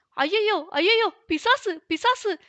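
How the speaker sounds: high-pitched panicked wail; terrified cry. A narrator's reading. Fallen rider wailing at a supposed devil, terrified cry. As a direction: cry out in terror, pitched well up. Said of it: "அலறிக்கொண்டு".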